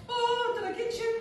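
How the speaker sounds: put-on high sing-song child's question; little girl voice. Little girl asking the commissioner if she scared her, high child voice. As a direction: play a delighted little girl. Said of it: "she goes".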